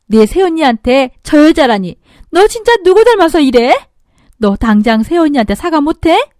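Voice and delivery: loud, high angry scolding; scolding mother. Acted. Mother-in-law scolding her daughter, angry. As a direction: scold your daughter hard.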